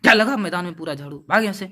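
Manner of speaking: loud, fast, angry headmaster yell; shouted scolding. Retold or imitated. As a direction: bark him out of the room. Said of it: "चीख करके डांट करके बोला". (चिल्लाकर डांटना)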